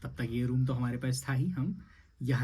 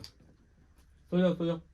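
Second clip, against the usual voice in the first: soothing; 4 semitones higher, much slower than usual. Acted. Lulling baby.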